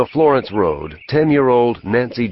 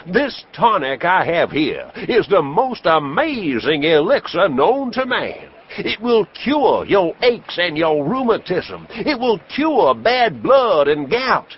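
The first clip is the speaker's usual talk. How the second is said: high, booming medicine show pitch; snake-oil salesman; 10 semitones higher than usual. Acted. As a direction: sell it hard to the crowd like a huckster. Traveling professor sells his elixir.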